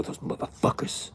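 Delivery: hissed, breathy rant; bitter muttering. Retold or imitated. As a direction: seethe under your breath once they're gone. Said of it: "she'd go".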